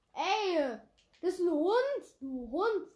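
loud, high indignant yell; outraged shout. Real, not acted. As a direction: shout it out in disbelief. Vom Gegner abgeschossen, empört. (empörter Ausruf)

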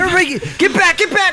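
high, rapid panicked shout; urgent warning. Acted. Warning everybody away from danger, frantic. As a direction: order them back in a panic.

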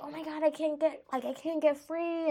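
high, strained imitation of a trapped villain; mock panic. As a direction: play the helpless villain, lightly. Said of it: "the guy's like".

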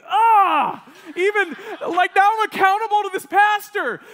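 high, loud cry of alarm; a young man panicking at the pastor's question, high and loud; panicked outburst. Retold or imitated. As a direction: blurt it out in sudden panic. Panicked thought, accountable to pastor.

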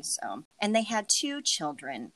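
ordinary talk

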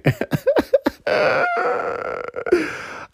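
long breathy high-pitched laugh; real laugh at a fond memory. Real, not acted.

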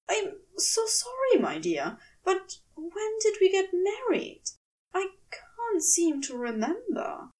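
high, fussy, apologetic angel voice; a bewildered gentleman pitched high; flustered angel. Acted. Aziraphale confused in a dream.

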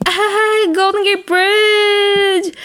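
high, slow, gushing Ah, Golden Gate Bridge; mock excitement. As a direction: squeal it like an overexcited tourist. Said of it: "the three of them were like".

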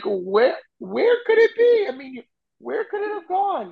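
a fussy giantess wondering aloud; giantess searching. Retold or imitated. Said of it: "she's like".

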